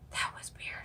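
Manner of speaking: breathy whispered aside; hushed weirded-out whisper. Real, not acted. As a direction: whisper it like a secret. Aside after being tickled.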